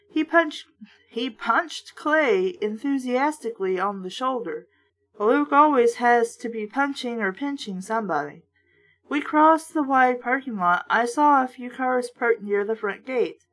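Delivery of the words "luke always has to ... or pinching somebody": ordinary delivery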